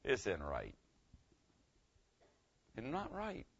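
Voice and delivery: hushed "It's not right"; quiet realization. Retold or imitated. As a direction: say it as the guilt sinks in. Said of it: "the thought hits you".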